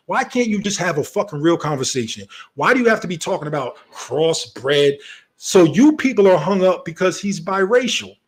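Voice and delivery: ordinary delivery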